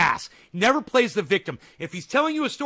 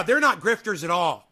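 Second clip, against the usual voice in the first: ordinary talk, nothing marked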